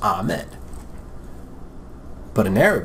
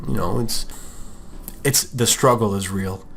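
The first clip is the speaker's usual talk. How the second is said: usual speaking voice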